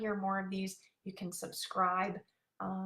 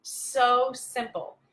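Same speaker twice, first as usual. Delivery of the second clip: drawn-out, louder mock amazement; slow amazed quote; 3 semitones higher, 9 dB louder, much slower than usual. Reading the client's comment aloud, slow and amazed. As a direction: stretch it out in wonder. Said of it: "See, she says".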